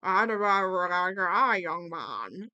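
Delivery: very drawn-out mock Irish accent; imitated Irish granddad. Imitating Eric's impression of his granddad, hammed-up Irish accent. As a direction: put on an exaggerated slow Irish granddad. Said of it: "he's doing an impression of his granddad".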